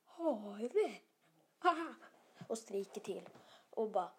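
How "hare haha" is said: stammering out words in fright; frightened stammer. Acted. Klas has spotted a huge hare, startled stammer. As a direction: play a scared child stuttering. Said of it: "bara stammar Klas och säger".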